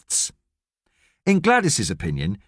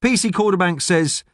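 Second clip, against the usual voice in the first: ordinary delivery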